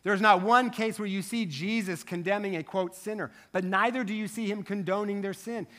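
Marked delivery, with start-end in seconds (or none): none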